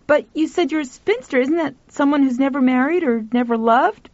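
put-on young boy, puzzled. A narrator's reading. Boy questioning the spinster, high puzzled voice.

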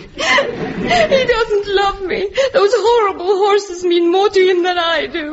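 long drawn-out comic bawling; tearful, self-pitying howl; crying. Acted. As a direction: wail it out, stretching the words. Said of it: "you mustn't cry".

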